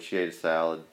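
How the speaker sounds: ordinary talk, nothing marked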